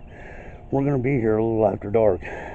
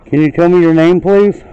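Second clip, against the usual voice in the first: very loud, raised request, rising on please; calling out to spirits; 6 semitones higher, 13 dB louder than usual. Real, not acted. Ghost hunter asking a spirit for its name, calling out loudly. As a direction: project a polite plea into the distance.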